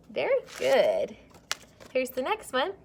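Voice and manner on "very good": ordinary talk, nothing marked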